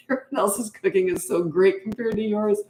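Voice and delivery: retold teasing with a laugh breaking in; amused retelling. Laughs retelling son's teasing remark.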